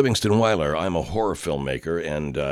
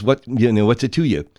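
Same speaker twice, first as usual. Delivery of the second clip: mock rabbit retort; 4 semitones higher, 6 dB louder than usual. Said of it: "You've got an overbite".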